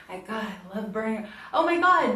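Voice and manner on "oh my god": exclaiming. Real, not acted. Complaining about the heat.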